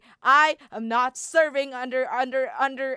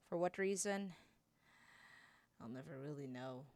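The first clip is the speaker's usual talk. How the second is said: low, soft, brooding monologue; a bitter warrior brooding alone in the dark; quiet, hurt young fighter; brooding Childe; 9 semitones lower, 16 dB quieter than usual. Acted.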